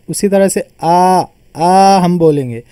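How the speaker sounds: आ drawn out long and slow; long vowel demo. Shown as a demonstration. Teacher stretching आ so students hear the long vowel. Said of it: "थोड़ा सा ये लंबा इसको खींचकर बोला जा रहा है".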